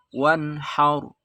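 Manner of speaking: Wanhar with the n said clearly; clear izhar. Shown as a demonstration. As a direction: pronounce the n in Wanhar crisply. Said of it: "Izhar artinya jelas".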